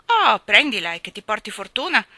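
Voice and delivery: loud, good-humoured blessing; gentle wit. Acted. King giving away the queen's necklace, gently witty. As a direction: hand it over lightly with a big "Oh". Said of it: "con gentile arguzia".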